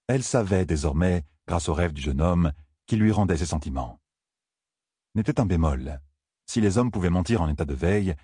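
ordinary delivery

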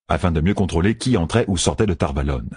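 plain reading voice

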